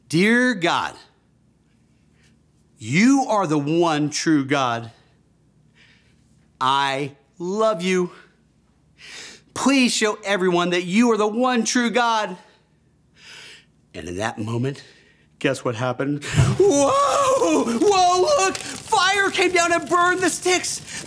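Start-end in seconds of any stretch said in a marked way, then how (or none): 0.1-12.4 slow, measured prayer with long pauses. Shown as a demonstration. Teacher leading children in a prayer to repeat, slow. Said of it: "Just repeat after me". 16.2-21.0 amazed. Acted. Teacher telling kids fire came down, astonished shout.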